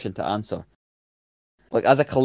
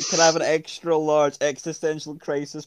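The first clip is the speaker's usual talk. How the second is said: customer voice; 4 semitones higher, 6 dB louder than usual. Pretend. Prank order to Domino's.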